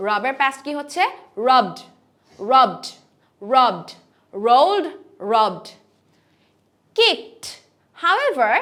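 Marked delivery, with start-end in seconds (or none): 1.5-5.7 loud রাবড, রোলড ending in D; strong final D. Shown as a demonstration. Teacher drills past tense, রাবড with strong D. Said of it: "strong একটা ডি উচ্চারণ". 7.0-7.4 high, crisp কিকড ending in T; strong final T. Shown as a demonstration. Teacher models past tense, কিকড with strong T. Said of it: "strongly একটা টি এর মতন".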